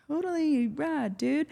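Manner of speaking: high, slow, hammed-up surfer drawl; mock surfer dude. Mocking herself to her surfer boyfriend, high surfer dude voice. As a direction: play the clueless wannabe surfer girl, slow and high.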